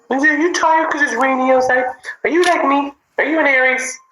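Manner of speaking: high cooing questions to a dog; high-pitched doting pet voice. Real, not acted. Talking to her dog.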